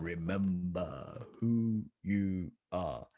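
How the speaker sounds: slow, deep, echoing lion king; Mufasa voice. Pretend. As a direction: speak slowly like a wise king from the clouds. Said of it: "if you need that image again".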